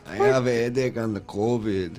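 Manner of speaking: softly hammed-up Mexican accent. Co-host continuing the requested impression, mock Mexican accent. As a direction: imitate a Mexican, keeping it soft. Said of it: "you do an impression there of, uh, an, a Mexican".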